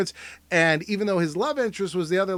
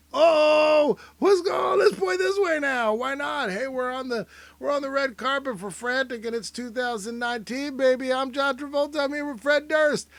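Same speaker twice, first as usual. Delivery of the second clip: hammed-up high Travolta; 10 semitones higher than usual. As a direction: play him as a goofy, thrilled star. Voicing Travolta in the photo.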